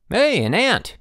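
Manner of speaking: high, loud exclaim at an ant; sudden delighted exclaim. A narrator's reading. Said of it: "he exclaimed".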